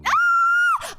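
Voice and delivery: delighted shout; excited shout. Real, not acted. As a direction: burst out happily. Delighted reaction to viewer.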